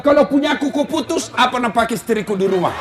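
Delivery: high, fast, laughing outcry; indignant shout. Retold or imitated. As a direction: protest in outrage. Husband protests cutoff. (suami memprotes keras sambil tertawa)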